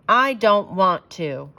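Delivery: slow, sulky refusal; stubborn little girl. A narrator's reading. Beatrice refusing to pick books, slow sulky whine. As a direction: drag it out like a pouting child. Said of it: "answered Beatrice".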